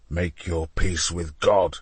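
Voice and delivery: low, slow deadly threat; cold threat. A narrator's reading. Alessandro tells Leonardo to prepare to die. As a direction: say it low and final.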